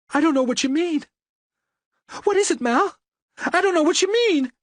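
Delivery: a cornered wife begging in panic; frightened woman. A narrator's reading.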